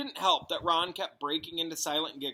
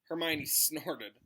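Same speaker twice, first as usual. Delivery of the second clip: ordinary delivery, nothing marked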